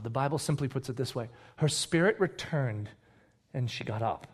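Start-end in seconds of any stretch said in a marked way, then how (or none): 1.6-4.3 subdued, reverent delivery; hushed reading. Acted. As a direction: read it with quiet awe, pausing midway. Reads the miracle, first take.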